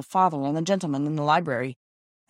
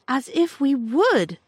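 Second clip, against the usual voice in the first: earnest protest; 8 semitones higher, 6 dB louder, much slower than usual. Acted. Daughter protests tenderly.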